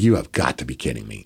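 low, hushed disbelief; retold disbelief. Wrestler hearing his opponent isn't cleared, low disbelief. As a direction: drop it low and soft, as if stunned. Reacting to news he's not cleared.

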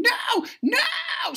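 very high, loud mock scream; imitated child screaming. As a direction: howl it like a child in a tantrum.

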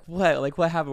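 loud, high shocked question. Retold or imitated. Hearing the meeting went badly, loud shocked question. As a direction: blurt it out in disbelief.

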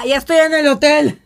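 put-on mildly slurred drunk husband on the phone; drunk friend. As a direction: play the lost drunk friend. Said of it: "él decía".

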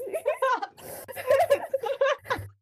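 a long giggling fit; can't stop laughing; long high laugh. Real, not acted. Laughing at own joke.

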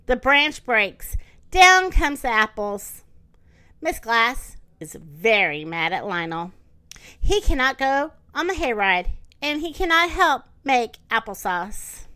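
slow, animated picture-book narration; expressive story time delivery; reading to children. Acted. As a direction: read it to little kids, drawing out key words.

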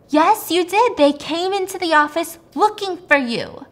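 high, loud acted accusation; raging. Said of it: "she's raging, she's mad".

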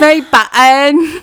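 put-on slow, loud Valley girl "My button"; Valley girl accent. Shown as a demonstration. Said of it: "Valley girl saying button".

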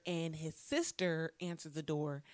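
ordinary talk

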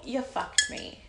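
ordinary delivery

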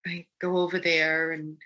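ordinary delivery

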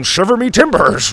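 loud, high fake pirate cry; hammed-up pirate. Grandpa trying to pass as pirate, hammed-up shout. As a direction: play an overeager amateur pirate.

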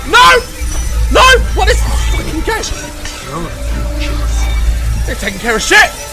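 panicked high shouting; screaming while fleeing; scared horror game streamer. Real, not acted. Chased by nun in horror game.

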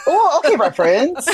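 high laughing greeting. Real, not acted. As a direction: laugh through the hello.

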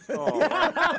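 high, mock-startled oh right; a laughing, squeaky fake shock; mock surprise. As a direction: play the dad caught off guard, laughing. Dad reacting to twelve tickets.

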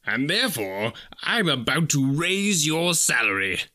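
slow, drawn-out reveal with a changed voice. Acted. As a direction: savour the surprise, slowly. Scrooge reveals the raise slowly.

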